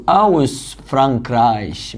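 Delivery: each syllable drawn out; deliberate slow model; very slow dictation. Shown as a demonstration. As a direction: spell out aus Frankreich for students. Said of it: "slow dictation of the answer".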